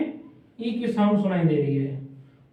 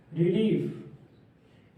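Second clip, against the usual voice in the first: Relief drawn out very slowly and softer; slow dictation; 4 dB quieter, much slower than usual. Shown as a demonstration. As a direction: stretch each sound of relief for dictation. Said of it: "relief. (slow repeat)". (Relief को बहुत धीरे और धीमी आवाज़ में खींचकर बोलें)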